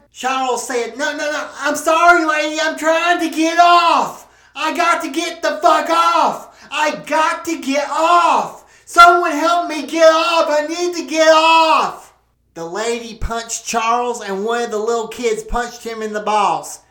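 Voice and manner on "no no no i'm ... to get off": high, loud pleading shout; desperate shouting. Acted. Trapped man shouting apologies at the angry woman.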